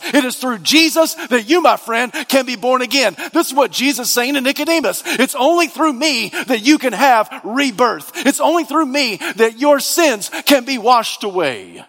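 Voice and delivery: high, urgent pulpit voice; impassioned preaching. Real, not acted. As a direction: preach it with fervent conviction. Preaches at full force.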